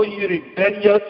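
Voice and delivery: ordinary talk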